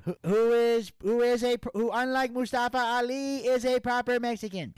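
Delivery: high, loud campaign voice; presidential candidate voice. Pretend. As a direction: boom it like a candidate at a rally. Campaign speech continues.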